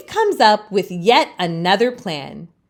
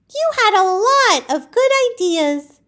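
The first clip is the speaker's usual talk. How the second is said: much higher, gentle consoling voice; kind friend Laurel; 12 semitones higher than usual. Acted. Laurel consoles Leaf, much higher voice. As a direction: comfort a sad friend, pitched way up. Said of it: "Laurel says".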